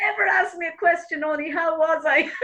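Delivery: real laughing. Real, not acted. Laughing at her own punchline.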